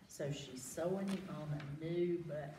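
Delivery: ordinary delivery, nothing marked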